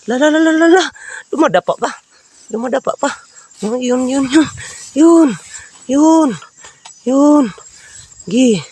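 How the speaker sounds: high loud urgent calls; excited calling. Real, not acted. Vlogger chasing the creature again, loud excited calls. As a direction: egg it on at full pitch.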